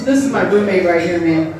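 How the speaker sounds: normal talking voice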